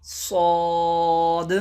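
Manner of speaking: صاد drawn out very slowly, heavy with a hissing s; slow, heavy صاد with a whistle; heavy whistling saad. Shown as a demonstration. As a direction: stretch صاد slow and thick. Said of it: "سیٹی والے حروف میں بھی شامل کر کے پڑھا اور مستعلیہ".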